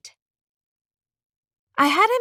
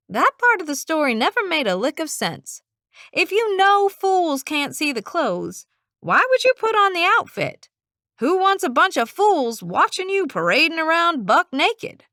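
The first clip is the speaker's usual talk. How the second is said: higher, louder, mocking disbelief; incredulous scoff; 7 semitones higher, 3 dB louder than usual. Darby scoffing at the emperor story, high and indignant. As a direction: scoff at the emperor's foolishness, rising in pitch.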